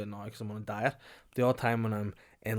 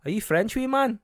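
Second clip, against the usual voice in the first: loud, much higher mocking cafe worker; cafe lady; 11 semitones higher, 7 dB louder, slower than usual. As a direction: squeak it up in disbelief as the cafe lady. Cafe worker voiced in story.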